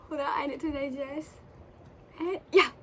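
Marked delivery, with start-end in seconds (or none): none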